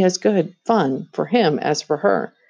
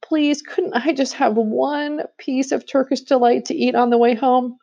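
put-on high, begging boy's voice; a lightly voiced boy pleading suddenly; sudden plea; 8 semitones higher than usual. A narrator's reading. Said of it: "said Edmund suddenly".

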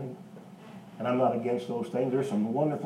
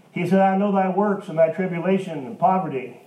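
raised, ringing pulpit voice; preaching at full force; 5 semitones higher, 7 dB louder than usual. Real, not acted. Preacher lifting his voice to the church, loud and ringing. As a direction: preach it out to the congregation.

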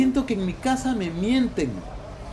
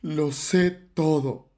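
grave confrontation; 8 semitones lower than usual. Acted. Shocked father tells his daughter, low and grave. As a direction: state it low and portentous. Dazed father tries the phrase.

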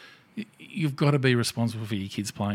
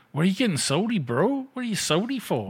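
high, mocking young gamer voice; a high mocking imitation of a whiny online gamer; 7 semitones higher than usual. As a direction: mimic a whiny teen taunting you.

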